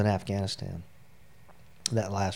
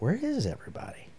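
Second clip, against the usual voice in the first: baffled; 12 semitones higher than usual. Retold or imitated. Dad left ordering alone, baffled and high-pitched. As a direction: let the pitch leap in bewilderment.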